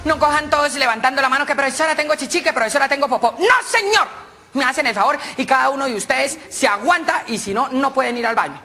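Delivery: a teacher warning her pupils, high and sharp; bossy teacher. Acted. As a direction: scold the class like a strict teacher. Teacher instructs class.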